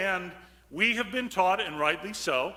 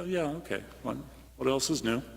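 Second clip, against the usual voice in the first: bored shrug; 6 semitones lower, 6 dB quieter, faster than usual. Retold or imitated. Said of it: "we just kinda go".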